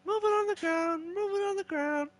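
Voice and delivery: high, small cartoon voice; Mario. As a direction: squeak it out softly, like a little game character. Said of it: "The Mario head thing".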